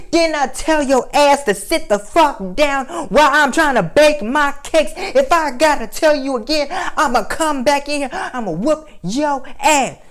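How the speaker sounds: put-on high, slow, scolding old grandma voice. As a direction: play grandma mid-baking, losing patience. Grandma scolding kid while baking.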